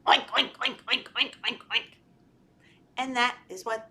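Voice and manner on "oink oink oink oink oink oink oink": quick playful oinking; pig oinks. Shown as a demonstration. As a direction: oink like a piggy for kids. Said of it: "what do they say".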